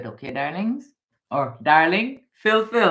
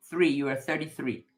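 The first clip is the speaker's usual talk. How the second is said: ordinary talk, nothing marked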